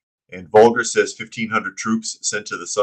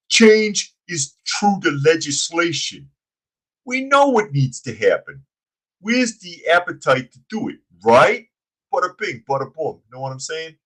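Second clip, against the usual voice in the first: dumb henchman voice; 7 semitones higher, 4 dB louder than usual. Pretend. Host mocking the mayor on legislation, henchman voice. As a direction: ham up the mayor as a dim wiseguy. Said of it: "J J likes the accent".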